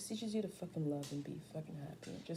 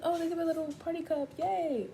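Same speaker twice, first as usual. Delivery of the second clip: high, mocking sarcastic yay; 11 semitones higher, 6 dB louder than usual. Girl mocking the gift she expected, high sarcasm. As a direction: sneer at the little party cup. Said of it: "I'm like".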